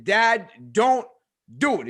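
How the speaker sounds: "don't... do it" split for emphasis; urgent plea. Retold or imitated. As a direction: plead with weight on "don't". Son begging father not to.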